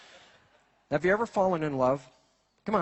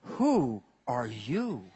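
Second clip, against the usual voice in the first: slow, low, stunned question; bewildered partner; 7 semitones lower, much slower than usual. Retold or imitated. Partner confronting a changed lover, slow and stunned. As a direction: ask it like you no longer recognize them. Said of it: "your partner looks at you and goes".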